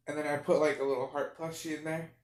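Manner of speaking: ordinary talk, nothing marked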